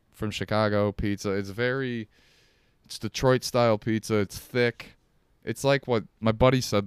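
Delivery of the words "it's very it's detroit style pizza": ordinary delivery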